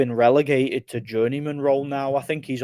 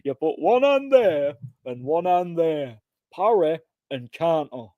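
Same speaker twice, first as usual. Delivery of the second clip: slow, higher put-on Nottingham accent, mock coach; imitating Nottingham coach; 5 semitones higher, slower than usual. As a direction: parrot the Nottingham coach Gaz slowly. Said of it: "his catchphrase".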